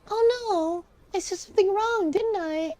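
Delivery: put-on high-pitched worried child; high little kid, dismayed. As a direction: fret like a nine-year-old. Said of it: "I'm reading in this kid voice".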